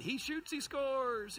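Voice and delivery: slow, high mock play-by-play call; sports announcer. Youth pastor gloating in his head, mock announcer call. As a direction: call it like a goal on TV. Thinking triumphantly in his mind.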